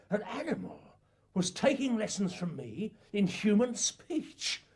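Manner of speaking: ordinary delivery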